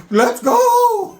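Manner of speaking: high, loud "let's go" yell; excited whoop. Retold or imitated. Hanging out of the golf cart, excited holler. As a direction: holler it out over a speeding ride.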